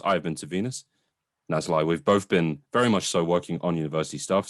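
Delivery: ordinary talk, nothing marked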